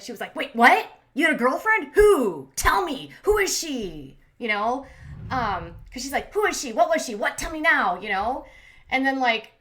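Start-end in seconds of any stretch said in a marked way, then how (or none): 0.3-4.2 loud, higher mock interrogation; raised voice. Retelling Steph grilling her girlfriend about an ex, raised voice. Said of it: "Steph raises her voice with her". 6.3-8.0 higher, louder imitation of jealous demands; mock interrogation, pushed up and insistent; raised voice. Retelling girlfriend demanding to know about the ex, raised voice. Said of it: "Steph raises her voice with her".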